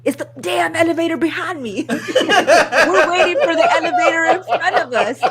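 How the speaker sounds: high, loud punchline through laughter; real laughing. Real, not acted. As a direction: crack up while delivering the punchline.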